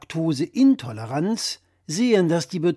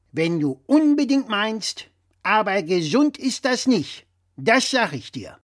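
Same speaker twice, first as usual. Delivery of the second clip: louder, higher know-it-all dad voice; blustering father; 6 semitones higher, 5 dB louder than usual. Acted. Father lecturing his son over the phone, louder and higher. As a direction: play the pompous father correcting his son.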